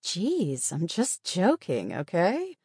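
drawn-out, eye-rolling protest, lifting at the end; exasperated. Acted. Patient to offended nurse and guard, exasperated. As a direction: brush it off with an eye-roll in the voice. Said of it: "rolling her eyes".

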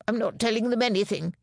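a short irritated snap; snapped. A narrator's reading. Prisoner snapping at Bobby, a mild curt snap. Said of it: "he snapped".

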